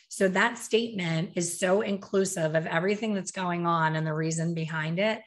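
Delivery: ordinary delivery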